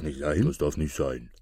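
low, soft gruff chief; gruff captain muttering; lightly voiced bandit leader; robber chief.

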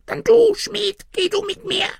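very high, squeaky, mournful voice; sadly pleading tiny man. A narrator's reading. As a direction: beg sadly as a little gnome. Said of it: "bat das Männlein mit gar trauriger Stimme". (traurig bittendes Männlein, hoch piepsend)

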